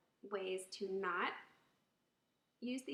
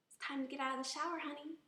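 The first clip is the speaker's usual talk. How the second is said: sweet, higher-pitched gentle request; pleasant tone; 6 semitones higher, faster than usual. Shown as a demonstration. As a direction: coax a child kindly. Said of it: "See the difference in the tone of voice".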